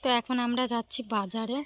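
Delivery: ordinary talk, nothing marked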